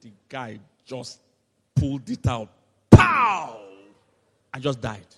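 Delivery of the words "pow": loud mock pow. Nail yanked out.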